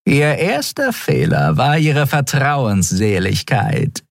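slow, high-pitched female villain; silky, gloating voice; taunting traitor. A narrator's reading. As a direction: savour the taunt, drawing it out. Shiar taunts her captured teacher.

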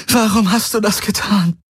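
very high anguished wail; high, tearful outcry; wailing in pain. A narrator's reading. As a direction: wail the question in grief. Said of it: "Kirai heulte vor Schmerz auf".

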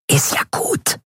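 high, whispery hiss back; hissed retort. A narrator's reading. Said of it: "zischte Orin zurück". (gereizt zurückzischen)

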